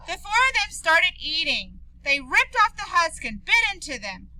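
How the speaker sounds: ordinary delivery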